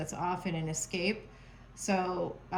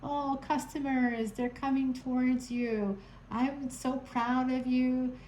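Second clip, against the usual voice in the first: higher, sugary mock of the threatener's text; mock sweet texter; 6 semitones higher than usual. Reader mocking the ex who threatened her, sugary. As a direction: ham up the fake friendliness. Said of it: "send me a text".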